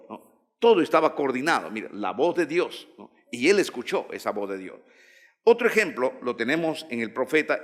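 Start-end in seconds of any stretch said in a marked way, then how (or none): none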